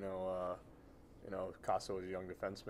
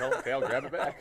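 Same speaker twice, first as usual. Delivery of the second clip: laughing; 6 semitones higher, 11 dB louder than usual. Retold or imitated. Retelling roommate giving back remote.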